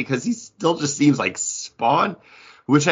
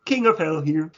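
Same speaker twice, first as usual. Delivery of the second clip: a light Kermit-style frog voice; mock Kermit voice; 8 semitones higher than usual. Copying Paul's Kermit take as King of Hell, light puppet voice. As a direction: play a cheery puppet frog. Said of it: "Hi, ho".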